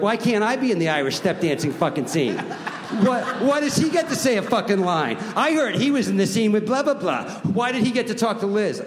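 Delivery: mock yell of jealous cousins wanting lines; whiny family complaints. Boston family demanding bigger parts, loud whine. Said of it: "they're like".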